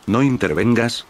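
ordinary delivery, nothing marked

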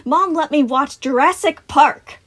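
put-on high, loud, slow kid brag; boastful child. A narrator's reading. Boy proving his bravery to Sun, boastful. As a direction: brag with a puffed-up chest. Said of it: "puffing up his chest".

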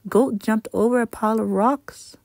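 ordinary delivery

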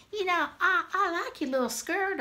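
high-pitched, sweet compliment; demonstrated chirpy praise to a child; friendly compliment. Shown as a demonstration. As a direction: coo a kind word to a little friend. Models kid complimenting a friend.

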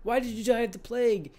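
high put-on daughter voice; voicing Anna. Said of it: "and she's like".

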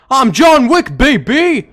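loud, high action-hero boast; shouted playful brag; hyped boast. Pretend. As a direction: crow it out at full volume. Hyped mock boast in game.

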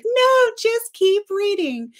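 high, slow, drawn-out imitation of her friend; mock bossy friend. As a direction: stretch it out high, teasing her stock advice. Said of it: "You're like".

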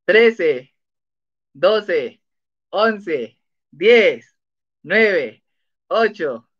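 cuenta atrás marcada, número a número; cuenta regresiva. Acted. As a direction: cuenta el tiempo a los alumnos, bien marcado. (cuenta regresiva para que respondan)

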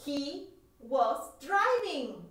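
He was driving drawn out very slowly, word by word; slow model answer. Shown as a demonstration. Teacher slowly modelling He was driving for students. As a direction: spell it out slowly for learners.

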